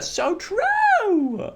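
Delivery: very high, sing-out squeal; gushing agreement. Acted. Cheering Canada as favourite country, high gushing squeal. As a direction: squeal it, stretching the last word.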